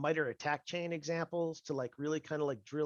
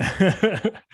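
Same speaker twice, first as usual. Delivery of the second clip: spooky voice; 6 semitones higher, 17 dB louder than usual. Pretend. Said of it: "the spooky over voice".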